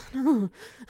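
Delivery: bright laugh; loud chuckle. Acted. Teasing laugh in roleplay.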